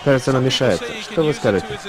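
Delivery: ordinary delivery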